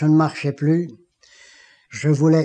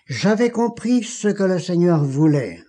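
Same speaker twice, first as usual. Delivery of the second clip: usual speaking voice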